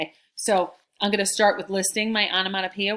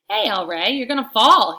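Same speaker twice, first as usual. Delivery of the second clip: loud, high friendly warning; called-out warning; 6 semitones higher, 11 dB louder than usual. A narrator's reading. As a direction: call it across the lunch table. Reads friend's warning in story.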